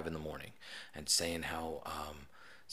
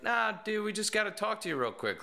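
calling out; 13 semitones higher than usual. Retold or imitated. Officer calling through door.

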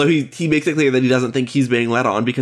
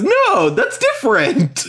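indignant refusal; 12 semitones higher, much slower than usual. Retold or imitated. John's retold refusal to JC.

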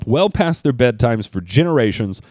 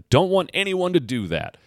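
normal talking voice

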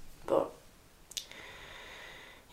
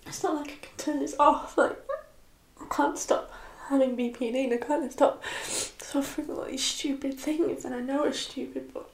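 real tearful voice, higher and strained; crying; 4 semitones higher, 3 dB quieter than usual. Real, not acted. Vlogger explaining her BPD to viewers, crying. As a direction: speak through real tears, choked up. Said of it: "I'm not really usually crying".

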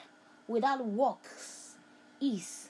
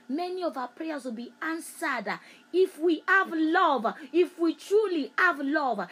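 louder, higher closing exhortation; preaching climax; 5 semitones higher, 5 dB louder than usual. Acted. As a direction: build to the climax.

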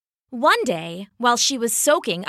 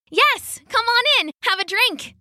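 much higher, bright and eager froggy voice; high, bubbly invitation; eager welcome; 13 semitones higher than usual. Acted. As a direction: welcome the guests like a thrilled little frog. Friendly froggy welcomes guests.

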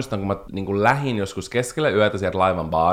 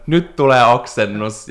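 pretend yell; 3 semitones higher, 6 dB louder, slower than usual. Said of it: "mä huudan".

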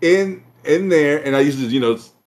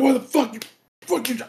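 mock angry uncle; 9 semitones higher than usual.

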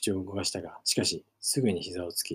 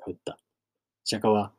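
usual speaking voice

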